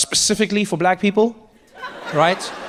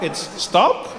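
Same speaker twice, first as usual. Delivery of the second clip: suggesting stop. Shown as a demonstration. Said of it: "more of a suggestion".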